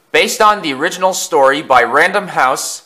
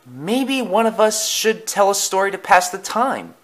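lightly voiced higher character; pitched up, floating an idea; Thomas suggesting; 8 semitones higher than usual. A narrator's reading.

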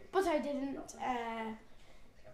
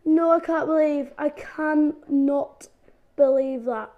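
much louder, higher no's slowing down; loud disbelief; 3 semitones higher, 11 dB louder than usual. Real, not acted. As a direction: stretch out the disbelief. Dies again, disbelief.

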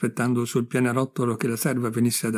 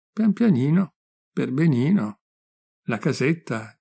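mocking echo. Friend climbing behind Gigione parrots his diminutives, mocking echo. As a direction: parrot his dainty little words back at him. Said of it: "tu mi parli anche in diminutivi".

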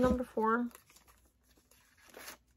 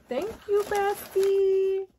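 gushing thanks; 9 semitones higher, much slower than usual. Real, not acted. Thanking bestie on camera.

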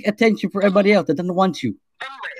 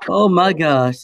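ordinary talk, nothing marked

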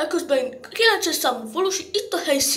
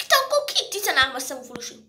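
"De akkor ki" fast, at an ordinary pitch; rapid question; faster than usual. Acted. Villager asking number thirteen who it is, rapid. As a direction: ask "De akkor ki" briskly, without the outburst. Same question repeated, quicker.

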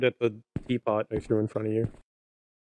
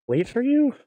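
incredulous; 13 semitones higher, 5 dB louder than usual. Real, not acted. Shocked at the remark.

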